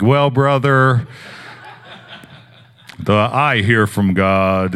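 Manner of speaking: slow, drawn-out, laughing send-up of a pious preacher; mock churchy spiel. Pastor mocking a weird spiel he did not give the stranger, slow and hammed-up. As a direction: ham up the spooky prophet, half laughing. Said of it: "And no, I did not do that at all".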